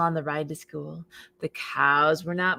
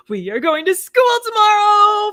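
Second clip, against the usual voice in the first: loud, high, thrilled; calls out the big news; excited announcement; 14 semitones higher, 11 dB louder than usual. Acted. Farmer Brown calling to the chickens, loud and high.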